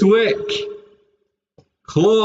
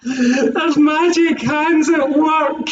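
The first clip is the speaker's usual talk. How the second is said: laughing; 10 semitones higher than usual. Real, not acted. Laughing about hands.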